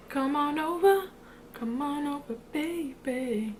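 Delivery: high, slow coaxing call to a pet. Real, not acted. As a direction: coax the cat over sweetly. Calling a pet over.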